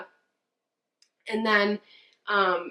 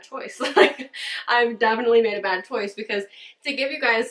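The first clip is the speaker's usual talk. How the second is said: laughing; 3 semitones higher, 4 dB louder than usual. Real, not acted. Laughs at her own bad choice.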